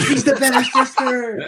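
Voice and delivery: loud, high, drawn-out taunt through laughter; slow, high-pitched jeer; trash-talking heckle. Retold or imitated. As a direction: yell it like a gloating heckler, laughing. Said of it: "talking so much shit".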